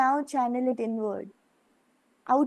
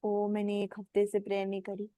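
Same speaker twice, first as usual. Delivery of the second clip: quick, low, softer mock fret; imitating a fretful disciple; 5 semitones lower, 6 dB quieter, much faster than usual. Teacher imitating a disciple's guilty excuse, quick and low. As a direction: toss it off like a worried student's excuse. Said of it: "Don't think about".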